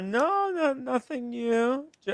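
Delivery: ordinary talk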